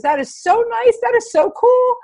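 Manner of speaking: very high, gushing imitation of a happy man; imitated delight. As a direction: act out thrilled appreciation in a high-pitched voice. Retold happy man's delighted reply.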